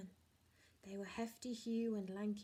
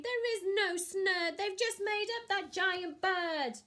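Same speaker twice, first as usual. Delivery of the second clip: complaining thief; 10 semitones higher, 9 dB louder than usual. Acted. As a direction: grumble that they've been tricked. Len complains.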